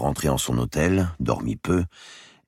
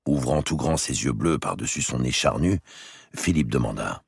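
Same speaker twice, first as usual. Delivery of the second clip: ordinary delivery, nothing marked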